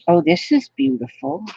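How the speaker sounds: usual speaking voice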